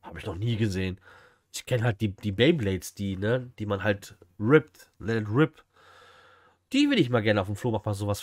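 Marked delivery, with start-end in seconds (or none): none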